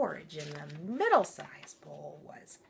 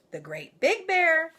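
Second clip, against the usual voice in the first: usual speaking voice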